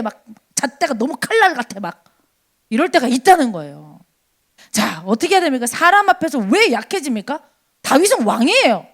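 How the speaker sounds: ordinary talk, nothing marked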